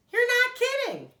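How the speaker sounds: very high, loud exclamation; astonished. A narrator's reading. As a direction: gasp out in amazement. Grover astonished at bags.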